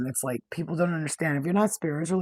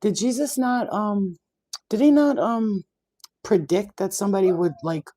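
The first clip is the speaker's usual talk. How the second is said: higher, louder rhetorical question; pointed challenge; 5 semitones higher, 6 dB louder than usual. Retold or imitated. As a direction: corner them with the question. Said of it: "I was like, um".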